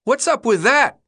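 high-pitched calling out, rising at the end; boy's shout. A narrator's reading. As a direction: shout it across like a puzzled kid. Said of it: "One shouted to Albie".

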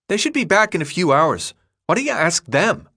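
mock the idea with sarcasm; sarcastic boy. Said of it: "Thomas ignored the sarcasm".

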